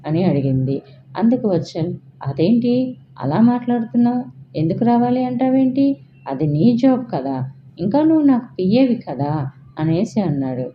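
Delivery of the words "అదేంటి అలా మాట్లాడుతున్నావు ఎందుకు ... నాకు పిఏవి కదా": indignant surprise, pitched up; surprised protest. Acted. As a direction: raise the voice in disbelief. Boss baffled at her refusal.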